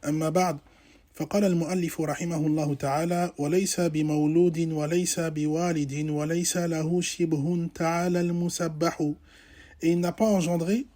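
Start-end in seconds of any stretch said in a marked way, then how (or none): 0.0-9.1 rapid, low, softly recited opening formula; formal Arabic recitation. Real, not acted. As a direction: recite the Arabic preamble fast, low and evenly.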